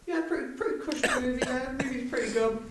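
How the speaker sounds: high, offhand put-on voice of a bored viewer; mock dismissive viewer. Mocking moviegoers who shrug at gore, high and offhand.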